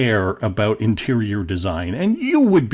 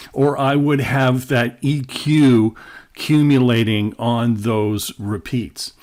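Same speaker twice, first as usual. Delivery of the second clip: usual speaking voice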